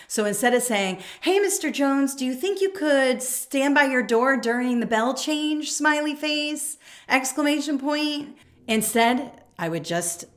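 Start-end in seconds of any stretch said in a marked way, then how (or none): 1.2-8.3 high, sweet, overly friendly ask; sugary soft request. Retold or imitated. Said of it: "smiley face or an exclamation point is not appropriate".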